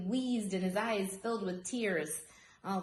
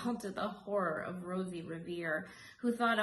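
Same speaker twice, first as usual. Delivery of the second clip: plain reading voice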